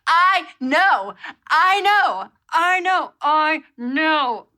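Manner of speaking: loud high repeated I know, pained; mock anguished agreement. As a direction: wail it in regret. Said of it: "And I was like".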